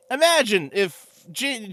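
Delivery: ordinary talk, nothing marked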